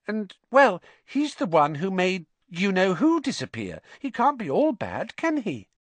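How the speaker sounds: high, hesitant schoolgirl; uncertain. A narrator's reading. Hannah defending Potter to Ernie, uncertain. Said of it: "said Hannah uncertainly".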